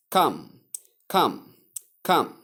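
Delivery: "come" repeated with the vowel of cup; correct pronunciation. Shown as a demonstration. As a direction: drill "come" short, as kum. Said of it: "as in cup".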